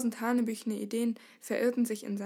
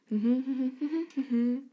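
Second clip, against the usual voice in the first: carefree, wordless marching tune; cheerful humming; 3 semitones higher than usual. A narrator's reading. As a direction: hum lightly as if strolling along. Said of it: "Singend marschierte Herkin". (fröhliches Summen)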